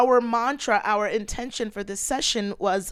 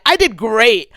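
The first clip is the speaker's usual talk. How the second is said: pretend boast; 8 dB louder than usual. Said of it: "lie for a second and be like".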